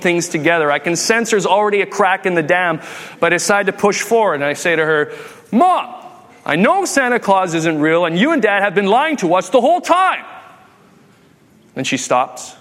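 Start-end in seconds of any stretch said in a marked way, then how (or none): 5.5-10.2 high-pitched indignant kid outburst, acted out; accusing child. Kid accuses mom and dad of lying, indignant. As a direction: build from a called-out Mom to a rising accusation.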